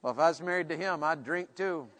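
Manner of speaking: soft, quick, dry grumble; wry aside. Acted. Preacher voicing a wrong reading about marriage, wry muttered aside. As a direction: toss it off under your voice. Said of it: "It doesn't mean".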